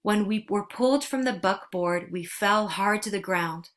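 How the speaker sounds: plain reading voice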